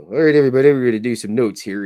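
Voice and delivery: lightly voiced teacher rallying the class; teacher addressing class. Teacher calling the class to take notes, lightly imitated. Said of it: "His teacher would go".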